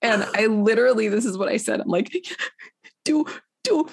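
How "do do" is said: much higher, halting, with a laugh; a small stammering girl's voice, much higher; timid teen question. Retold or imitated. Fifteen-year-old answering the pastor, timid and much higher.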